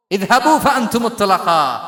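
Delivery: loud, slow Arabic declamation; slow ringing shout; proclaiming amnesty. Retold or imitated. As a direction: proclaim it to a whole crowd, drawn out.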